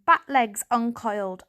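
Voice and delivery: usual speaking voice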